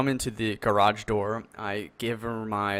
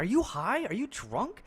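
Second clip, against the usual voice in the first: incredulous; 10 semitones higher than usual. Retold or imitated. Retold argument.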